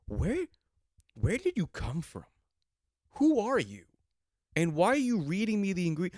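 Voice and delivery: high, baffled, rising outburst; incredulous. Retold or imitated. Telling the organic woman off at the counter, incredulous and high. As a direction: demand to know who she is, climbing high in disbelief. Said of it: "I go".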